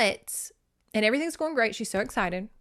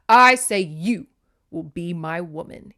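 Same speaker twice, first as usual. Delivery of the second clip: insistent demand; 2 semitones lower, 7 dB louder than usual. A narrator's reading. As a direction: insist as a domineering storybook suitor. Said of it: "he insisted".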